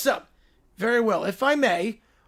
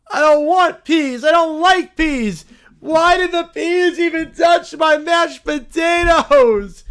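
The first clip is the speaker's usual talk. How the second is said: put-on whiny kid; 4 semitones higher, 4 dB louder than usual. Picky kids complaining about Christmas dinner, loud whining. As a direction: whine and yell at the dinner table. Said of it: "The kids are not impressed".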